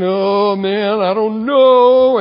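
slow, drawn-out uncertain groan on the bridge; a worried man stepping onto a bridge, slow and unsure; doubtful hesitation. Retold or imitated. Preacher imitating a nervous doubter on a bridge, drawn out. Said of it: "and you're thinking".

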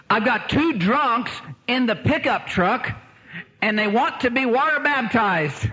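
high, drawn-out emphatic report; acted excited announcement. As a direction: announce it big, for a laugh. Acts out friend Joe at door.

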